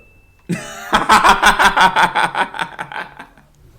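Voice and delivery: long, high burst of laughter; big loud laugh. Real, not acted. Host releasing tension after tearful segment, roaring laughter. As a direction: crack up loudly.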